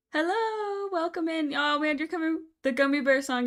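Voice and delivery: high, loud cheerful welcome; excited greeting. Real, not acted. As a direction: greet the newcomers bursting with energy. Greets new viewers.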